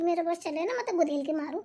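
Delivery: ordinary talk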